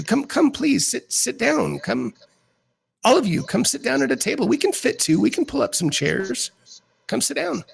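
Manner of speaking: a high eager hostess seating her guests; welcoming hostess voice. Acted. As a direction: usher everyone to the table with a bright lilt. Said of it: "the woman who introduces herself as Josephine".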